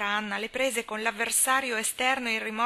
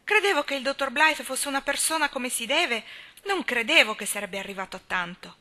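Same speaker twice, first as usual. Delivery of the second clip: put-on louder, higher, alarmed old lady; indignant old spinster; 5 semitones higher, 7 dB louder than usual. A narrator's reading. Miss Cornelia confronting Anna about the operation, loud and alarmed. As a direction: burst in scandalised and loud.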